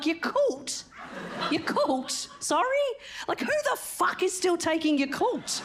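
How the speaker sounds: high, slow disbelief; incredulous. Retold or imitated. Mother scoffing at the health professional, high disbelief. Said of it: "I'm like".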